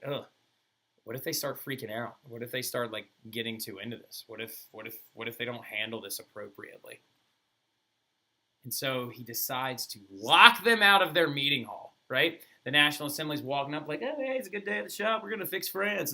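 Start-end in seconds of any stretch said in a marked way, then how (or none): 0.0-7.0 softer, fretful mock worry; mildly worried king. Louis nervous and worried. 14.0-16.1 higher, quick, sing-song mock cheer; cheerful naive. Assembly walking up cheerful.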